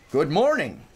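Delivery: cheerful hearty morning greeting. A narrator's reading. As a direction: call out a big friendly hello to the camp. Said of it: "with a hearty".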